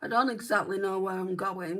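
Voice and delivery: usual speaking voice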